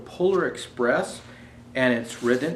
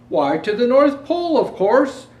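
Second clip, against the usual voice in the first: higher, louder, cheerful conductor; bright conductor voice; conductor's answer; 10 semitones higher, 8 dB louder than usual. Acted. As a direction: answer as if it's obvious. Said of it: "was his answer".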